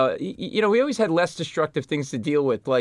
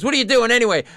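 put-on higher, louder drunken challenge; drunk heckle; 9 semitones higher, 7 dB louder, faster than usual. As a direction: call out the comic from the floor. Bleeding drunk heckles comedian.

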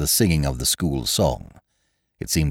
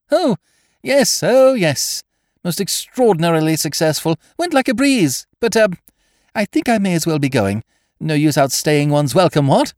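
put-on high, blustering young toff; bluffing toff; 13 semitones higher, 6 dB louder than usual. Wooster to Jeeves, high and blustering. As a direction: bluff it out, eager to leave.

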